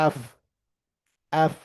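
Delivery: এফ with an open "a" vowel, "aef"; corrected English F. Shown as a demonstration. Teacher drills the class on F, corrected pronunciation. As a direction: model এফ slowly apart with a wide vowel. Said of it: "এটা কি বলতে হবে".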